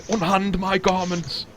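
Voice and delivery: high, drawn-out outraged command; acted outrage, imperious and high; indignant demand. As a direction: cry it out slowly, pitched well up. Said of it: "his eyes just light up with indignation".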